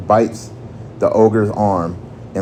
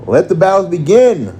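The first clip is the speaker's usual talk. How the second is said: high-pitched announcer's cry, a little louder; narrated scream; 9 semitones higher, 4 dB louder than usual. A narrator's reading. Game Master opens the battles to the crowd, screaming it out. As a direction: call it out high to open the fight. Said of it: "screams the Game Master".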